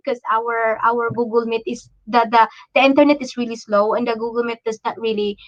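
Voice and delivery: ordinary talk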